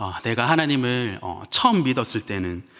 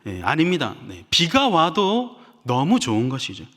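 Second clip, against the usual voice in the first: ordinary talk, nothing marked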